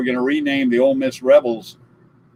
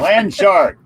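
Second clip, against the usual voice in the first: louder call of "Land Shark"; loud announcement; 4 semitones higher, 4 dB louder than usual. Shown as a demonstration. Announces the name.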